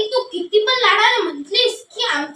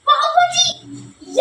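mother's reply; 5 semitones higher, much slower than usual. Acted. Mother answers the boy.

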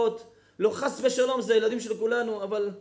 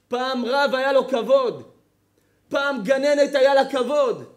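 building sermon cadence, louder on each repeat; rising preacher's cry; preaching; 3 semitones higher, 6 dB louder than usual. Acted. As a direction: hammer home each repeated phrase like a preacher. Rabbi preaching, rising repetitions.

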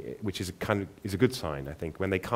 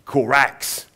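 loud, higher raven cry with a Cockney twang; raven's London croak; 5 semitones higher, 8 dB louder than usual. Acted. Raven introducing itself to Aubrey, loud Cockney caw. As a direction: squawk it like a big London raven. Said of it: "loud, throaty voice and an accent".

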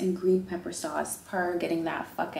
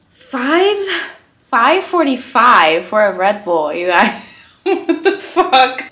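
loud, slow outraged disbelief; price shock; 3 semitones higher, 12 dB louder, slower than usual. Real, not acted. Vlogger shocked by a pricey Red Bull, outraged disbelief.

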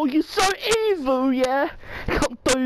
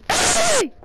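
high, loud screaming at a cheater; furious shout; 4 dB louder, slower than usual. Real, not acted. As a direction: yell her name in outrage. Doll turns off the lights.

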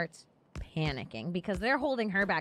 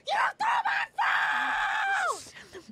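drawn-out, very high-pitched shriek; imitated screaming; 3 dB louder, much slower than usual. Passenger screaming after her phone was thrown, imitated screaming. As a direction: scream it slowly at the top of your range. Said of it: "she's screaming".

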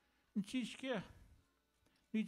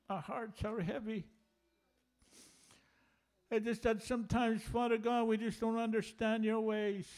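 praying. Acted. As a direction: pour out the grief in prayer.

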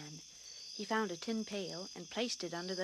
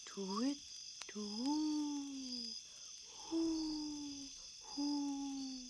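high hooting owl; 6 semitones higher, much slower than usual. A narrator's reading. Narrator hooting the grumbly owl in the tree.

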